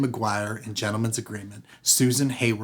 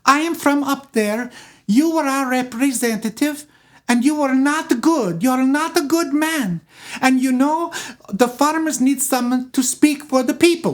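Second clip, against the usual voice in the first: fiery Katie; 14 semitones higher, 4 dB louder than usual. Retold or imitated. Katie confronts congressman.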